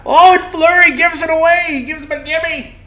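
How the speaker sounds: high, loud excited commentator call; Pierre McGuire. Retold or imitated. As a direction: shout it like a hyped TV analyst. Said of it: "I really enjoyed Pierre Maguire".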